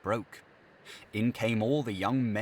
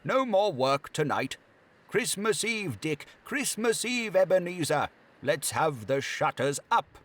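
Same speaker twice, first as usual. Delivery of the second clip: much higher, louder merry orders; jovial master; 8 semitones higher, 4 dB louder than usual. Acted. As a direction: jolly boss ending work for Christmas. Fezziwig cheers his apprentices.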